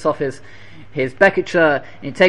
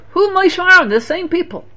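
much higher, pointed rhetorical cry; rhetorical exclaim; 13 semitones higher, 4 dB louder than usual. Real, not acted. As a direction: hit the question hard. Rhetorical question in sermon.

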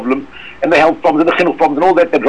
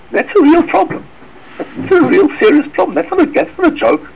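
normal talking voice

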